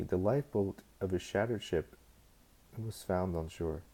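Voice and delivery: plain reading voice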